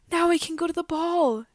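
overjoyed cheer. A narrator's reading. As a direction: Cinderella cheering with delight. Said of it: "cheered Cinderella".

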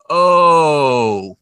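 slow-motion swear, stretched very long; drawn-out dismayed cry. Retold or imitated. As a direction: imitate a slow-motion "oh no" moment, loud and high. Boy swears as bolts fly.

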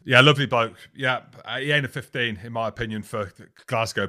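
normal talking voice

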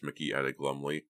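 usual speaking voice

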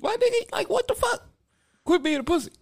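very high, squeaky incredulous protest; loud, high-pitched mock outrage; exasperated scolding. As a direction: scold him in disbelief, voice shooting up into falsetto. Mock scolding of the film hero.

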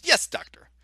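high breathy assistant voice; a high, eager yes; Inga voice. Retold or imitated. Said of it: "Inga says".

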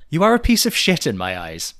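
emphatic read-out, short of yelling; recital without impression. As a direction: recite the insult firmly, holding back the venom. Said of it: "I won't do it with an impression".

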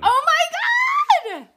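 high, slow laughing scream of joy; ecstatic shriek. Real, not acted. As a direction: squeal with laughing delight. Overjoyed reaction to theme song.

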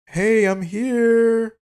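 very high, slow, sing-song greeting; mock breezy arrival. Mocking the boyfriend who shows up unannounced, high and drawn out. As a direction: stretch it out high and carefree like a casual drop-in. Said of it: "and just be like".

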